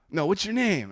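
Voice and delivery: high-pitched mock dispatcher; emergency operator. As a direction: imitate an operator prompting a caller.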